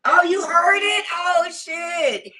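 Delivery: surprised. Real, not acted. Reacts to viewer's comment.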